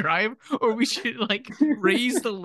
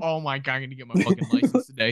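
mock confident kid; 8 semitones lower than usual. Mocking friend's overconfidence.

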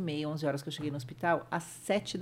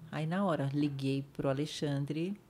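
normal talking voice

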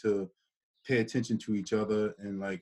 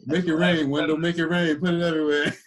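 loud, high playful hype; excited; 7 semitones higher, 8 dB louder than usual. Pretend. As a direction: hype it up like tossing cash. Joking about throwing money around.

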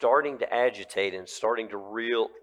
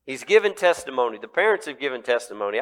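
normal talking voice

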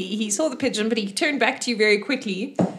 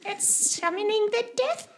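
terrified gnome; 13 semitones higher, slower than usual. Acted. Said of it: "He's like".

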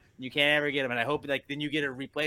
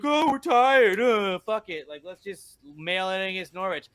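high, mocking whine, easing off; mock-exhausted moaning; whining tired team; 5 semitones higher, 4 dB louder than usual. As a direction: whine like players who can't be bothered. Imitating his tired, lazy team.

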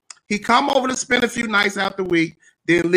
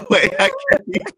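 talking through laughter; words breaking into laughs; laughing; faster than usual. Real, not acted. Breaks up mid demo.

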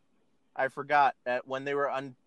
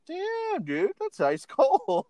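amazed; slower than usual. Real, not acted. Reacts to insult, laughing.

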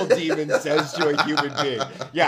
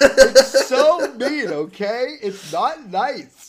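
mock scolding; 5 semitones higher, 5 dB louder, much slower than usual. Real, not acted.

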